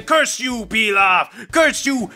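a lightly voiced, mock-outraged fan, slowing on the last line; hammed-up indignation from a fan defending his waifu; furious fan. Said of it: "furiously typing responses of people saying".

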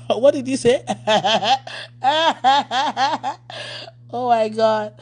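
giggling through what did you say; laughing. Real, not acted. Pastor laughing at her own confusion. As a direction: laugh it out mid-question.

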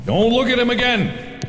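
high-pitched alarmed self-warning; alarmed outcry. Real, not acted. Player dodging the principal in a game, alarmed. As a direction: yelp it as a sudden warning to yourself. Panicking at being caught by principal.

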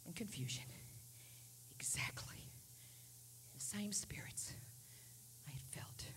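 unvoiced soft whisper; hushed whisper. Preacher recalling the dark spirits, whispered. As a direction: sink down to a whisper.

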